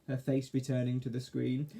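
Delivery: usual speaking voice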